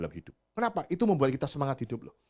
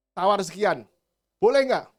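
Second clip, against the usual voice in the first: louder mock buyer asking, rising high; haggling buyer; 6 semitones higher, 8 dB louder than usual. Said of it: "kontraktor gitu".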